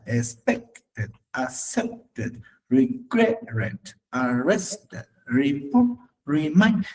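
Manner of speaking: "Expected, accepted, regretted, arrested, report, remind", each leaning hard on syllable two; second-syllable stress. Shown as a demonstration. Teacher lists words sharing the accent, stressing second syllables. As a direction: break up each word: ex-PEC-ted, ac-CEP-ted, re-GRET-ted, ar-RES-ted, re-PORT, re-MIND. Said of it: "tienen el acento en esa".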